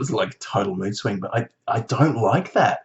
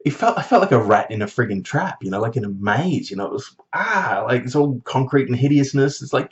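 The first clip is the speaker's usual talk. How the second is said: ordinary delivery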